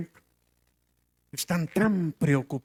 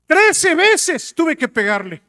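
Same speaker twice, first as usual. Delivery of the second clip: hija desesperada; 9 semitones higher, 6 dB louder, slower than usual. Retold or imitated. Hija desesperada cuenta al padre.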